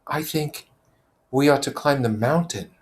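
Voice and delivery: timid suggestion to the circle; Simon's voice. Acted. Simon hesitantly proposing the climb to Piggy.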